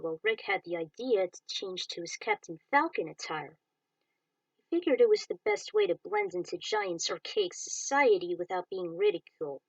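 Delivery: ordinary delivery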